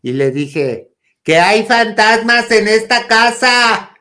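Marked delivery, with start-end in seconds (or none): 1.2-4.0 high, slow, loud announcement; hammed-up cry of alarm; retold shouting. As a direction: yell the alarming news, drawing out each word. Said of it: "gritando, yo diciéndole a mi mamá".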